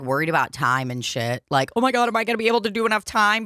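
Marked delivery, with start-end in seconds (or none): 1.8-3.4 fast, rushed mock panic; imitating a headliner's nervous worry. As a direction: rattle it off like a nervous comic. Libby worried about stage time.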